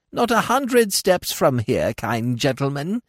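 high, slow, droning crone voice; monotonous old woman. A narrator's reading. Old woman drones her answer to Smith. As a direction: drone it out like a tired old beggar. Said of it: "she replied in the monotonous voice".

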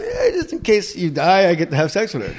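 hammed-up couple's squabble; mock argument. Acts out couple's Instagram argument.

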